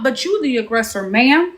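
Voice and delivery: normal talking voice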